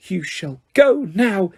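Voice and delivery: high elf voice, slow and fierce; fierce Dobby. A narrator's reading. Elf ordering Malfoy away, fierce and slow. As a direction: order him out, word by word. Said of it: "he said fiercely".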